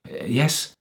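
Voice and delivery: anxious, tentative prompt; trembling. A narrator's reading. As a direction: answer nervously after a faltering start.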